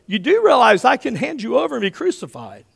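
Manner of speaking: high, loud Pilate pulling rank; indignant threat. Acted. Pilate warning Jesus of his power, loud and indignant.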